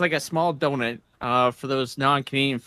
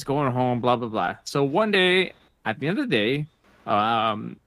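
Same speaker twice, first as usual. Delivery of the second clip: ordinary talk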